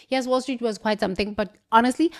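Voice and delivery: ordinary talk, nothing marked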